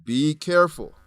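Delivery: ordinary talk, nothing marked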